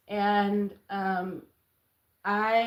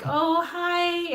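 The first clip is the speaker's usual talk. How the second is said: put-on high, airy ditzy girl voice; valley girl voice; 9 semitones higher, 3 dB louder than usual. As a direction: sound like a clueless young girl. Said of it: "This is how I used to talk".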